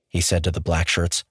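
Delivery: plain reading voice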